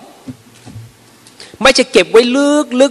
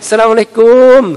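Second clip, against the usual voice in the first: effusive greeting; 3 semitones higher, 3 dB louder than usual. Acted. Another grandchild arriving to charm grandmother, effusive greeting. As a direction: greet grandmother as if delighted to see them. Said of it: "แต่อีกคนนึงมา หลานอีกคนมายังไง".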